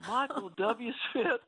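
high, slow mock holler; imitating proud dad. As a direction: announce it up high, stretched out, as Dad. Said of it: "He said".

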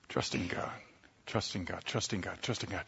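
breathy whispered repetition, fading away. Acted. Preacher to congregation, weighing the choice in a whisper. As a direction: murmur it to yourself like a secret.

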